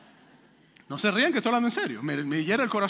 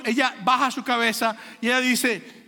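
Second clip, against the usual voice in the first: ordinary delivery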